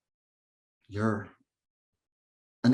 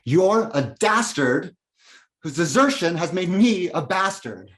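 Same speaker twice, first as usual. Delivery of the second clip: higher, forceful angry denunciation; bitter accusation; 6 semitones higher, 4 dB louder than usual. Acted. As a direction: throw the blame at your father. Climax of tirade against father.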